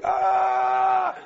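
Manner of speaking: a huge high shout, imitating the healed man; hammed-up running scream; mock scream. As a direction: belt out a joyful yell as you retell it. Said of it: "waving his crutches over his head and screaming".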